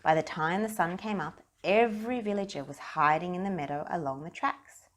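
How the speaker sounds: usual speaking voice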